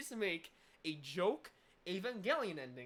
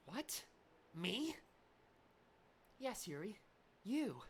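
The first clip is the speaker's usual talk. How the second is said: normal talking voice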